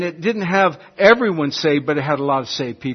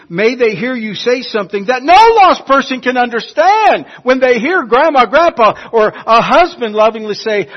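high, loud, building preacher's cry; fiery preaching; 7 semitones higher, 8 dB louder than usual. Acted. Preacher urging the brethren, at full force.

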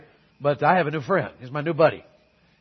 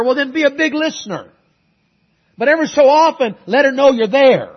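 loud, high-pitched, fervent preaching; preaching at full force; 8 semitones higher, 9 dB louder than usual. Acted. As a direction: drive the advice home like a revival preacher. Pastor exhorts couples.

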